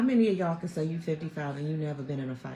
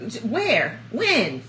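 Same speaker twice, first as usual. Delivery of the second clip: sister eager to fight; 11 semitones higher, 4 dB louder, much slower than usual. Retold or imitated. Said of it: "my sister be like".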